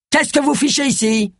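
high-pitched irritated bark; barked question. A narrator's reading. Inspector challenging Agatha on sight, barking. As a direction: bark it without really shouting. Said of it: "aboya-t-il".

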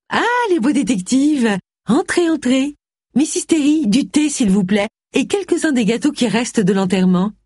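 high-pitched, gushing lady of the house; effusive hostess. A narrator's reading. Cassandra welcomes the detectives and orders tea, effusive. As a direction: sweep guests in and order tea.

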